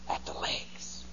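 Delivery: breathy whisper of the punchline. Acted. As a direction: drop to a whisper for the zinger.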